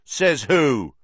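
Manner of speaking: put-on a boy shouting back at the teacher; loud challenge. A narrator's reading. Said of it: "said Dean Thomas loudly".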